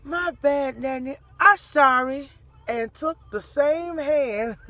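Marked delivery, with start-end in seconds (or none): 0.0-2.3 put-on toddler apology.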